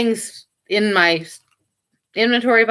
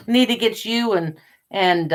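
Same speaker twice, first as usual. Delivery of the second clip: normal talking voice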